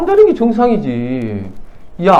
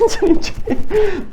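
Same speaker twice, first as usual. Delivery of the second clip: mock prayer; 14 semitones higher, much slower than usual. Mocking empty prayer.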